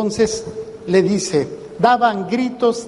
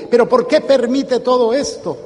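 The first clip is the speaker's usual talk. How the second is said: complaining; 6 semitones higher than usual. Retold or imitated. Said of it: "le reclamo".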